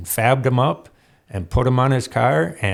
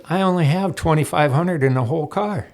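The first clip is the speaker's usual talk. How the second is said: higher voice, playful disbelief at the prize; amazed friend; 6 semitones higher than usual. Retold or imitated. Friend jokes the winnings beat his whole car, higher and amazed. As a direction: rib him that the prize beats his car.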